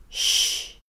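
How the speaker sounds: Sch. breathed out unvoiced as a long soft shch. Shown as a demonstration. Teacher demonstrates the shch sound, unvoiced. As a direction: hiss it out without voice. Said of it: "sch spricht man wie tsch und sch zusammen".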